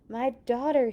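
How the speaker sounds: urgent loud plea from a desperate father; pleading. A narrator's reading. Father begs Jesus to save his daughter, pleading. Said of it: "he pleaded".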